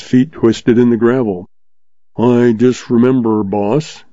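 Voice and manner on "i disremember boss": ordinary delivery, nothing marked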